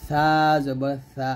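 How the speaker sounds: slow "সা জবর সা", tongue tip on the upper teeth tips; slow letter demo. Shown as a demonstration. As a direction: draw out a thin "সা". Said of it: "উপরের দাঁতের আগা লাগাবেন".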